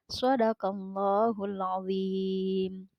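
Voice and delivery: slow, melodic tilawah chant with drawn-out vowels; Quran recitation. Acted. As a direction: recite the verse slowly like tilawah. Teacher recites Quran verse. (lantunan tilawah Al-Quran yang lambat)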